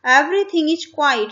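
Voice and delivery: put-on slow, clear reading to children; slow reading aloud. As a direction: read each word out slowly for the class. Teacher reading the text slowly.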